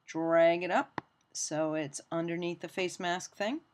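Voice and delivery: normal talking voice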